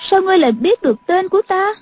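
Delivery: put-on high-pitched girl's voice, puzzled; surprised young girl. A narrator's reading. Girl wonders how he knows her name, surprised. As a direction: ask back in a high, girlish pitch. Wide-eyed girl asks back.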